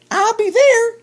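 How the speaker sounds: very high, squeaky little chipmunk voice; tiny chipmunk voice. Acted. Chipmunk greeting Bear at the party, very high squeaky voice. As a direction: pitch way up like a small sweet chipmunk.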